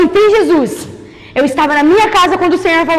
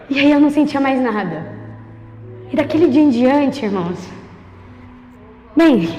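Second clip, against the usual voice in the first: low, soft, solemn testimony; testemunho em voz contida; 7 semitones lower, 5 dB quieter than usual. Real, not acted. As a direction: let it settle into a quiet, halting confession. Hushed, moved testimony after climax. (testemunho baixo, contido, com pausas)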